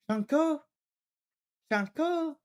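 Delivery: silly high voice. Pretend. Repeats name in silly high voice.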